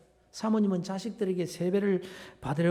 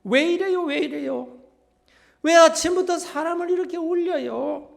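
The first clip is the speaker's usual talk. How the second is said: higher, louder imitation of upset family; mock tearful protest; 8 semitones higher, 6 dB louder than usual. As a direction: act out a family's weepy protest. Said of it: "온통 울음바다가 되는 겁니다".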